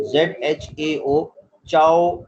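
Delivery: चाओ with a clear ch, spelt out slowly first; ch sound. Shown as a demonstration. Teacher spells and pronounces चाओ for students, clear ch. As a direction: spell Z H A O then say चाओ. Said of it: "यानी चे की sound".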